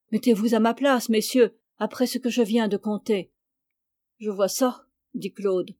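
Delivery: plain reading voice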